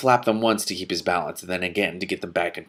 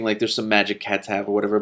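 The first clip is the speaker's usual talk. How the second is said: ordinary delivery, nothing marked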